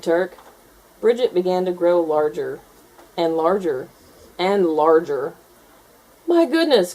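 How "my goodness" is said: high startled cry; teacher gasps at the growing gator; surprised cry. A narrator's reading. Teacher startled by the growing gator, high surprised cry. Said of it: "cried Miss Pickles".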